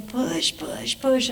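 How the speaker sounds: high, hushed, drawn-out urging; doula urging. Retold or imitated. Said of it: "the doula's going".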